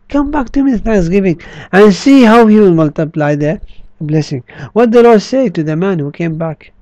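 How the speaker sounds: ordinary talk, nothing marked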